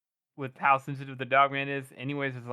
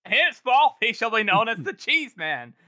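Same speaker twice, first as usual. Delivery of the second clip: loud, high-pitched decree; mock-formal herald's announcement; mock proclamation; 8 semitones higher, 5 dB louder than usual. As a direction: proclaim it grandly, tongue in cheek. Mock decree renaming the cheese man.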